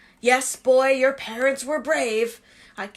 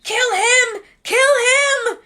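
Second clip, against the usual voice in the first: high, loud frantic yell; 12 semitones higher, 8 dB louder, much slower than usual. Acted. Voldemort yelling at Quirrell, high and loud. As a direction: yell the command, rising. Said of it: "Voldemort yelling".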